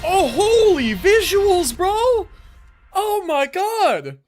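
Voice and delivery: normal talking voice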